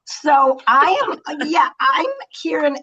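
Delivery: real crying in the voice; tearful. Real, not acted. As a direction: push through the tears slowly. Moved to tears on screen.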